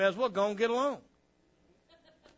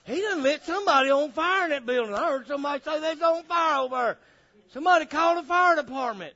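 high, raised mock panic; alarmed neighbor; 8 semitones higher, 6 dB louder, faster than usual. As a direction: sound the alarm like a rattled onlooker. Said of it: "people in the neighborhood going".

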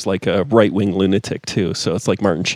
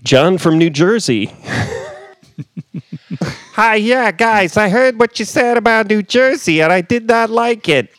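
prank caller voice; 12 semitones higher than usual. Pretend. Mock caller from New Jersey ringing the podcast. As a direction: play a threatening caller phoning in. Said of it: "Oh, we got a caller".

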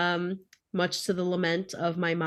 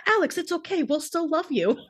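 husband comforting; 8 semitones higher, faster than usual. Retold or imitated. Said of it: "Doug was like".

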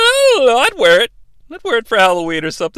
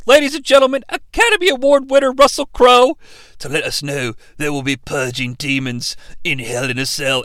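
ordinary delivery

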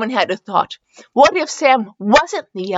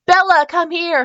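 raised-pitch, mildly stern order; mild growled command; 9 semitones higher than usual. Acted. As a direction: call someone over with a touch of growl. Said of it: "Sam growled".

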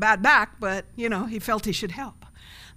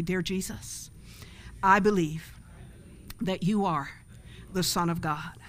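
led prayer; 3 semitones lower, 3 dB quieter than usual. Acted. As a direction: pray it so they can repeat it. Leads sinner's prayer.

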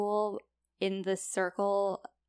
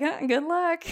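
smug demon; 9 semitones higher, 6 dB louder than usual. Retold or imitated. Smartass demon voiced.

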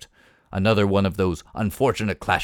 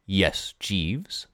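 suave; 3 semitones lower than usual. Acted. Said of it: "though my voice was suave".

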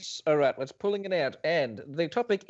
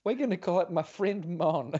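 ordinary talk, nothing marked

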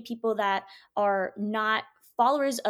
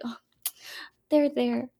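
tender God comforting; slower than usual. Pretend. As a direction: coo like a loving parent to a baby. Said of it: "He's like".

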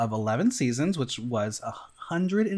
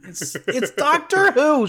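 excited; 11 semitones higher, 6 dB louder, slower than usual. Real, not acted. Excited about Doctor Who merch.

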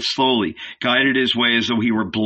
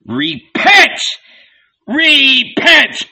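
high, loud prophet's cry; full-voiced call to repentance; bellowed; 8 dB louder, much slower than usual. A narrator's reading. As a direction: bellow it out in two long bursts. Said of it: "bellowed the son of Jonah".

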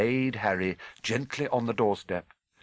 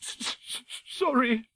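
stuttering tearful apology, pitched high; sobbing. Acted. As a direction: choke out the word through sobs. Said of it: "sobbed Hagrid".

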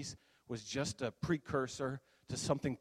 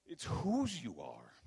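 "whose" repeated slowly with a long falling swoop; stress on whose; slower than usual. Real, not acted. Preacher repeating his main point to the room, "whose" slowly drawn out. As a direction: lean long on "whose", then drop away. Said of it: "but that point".

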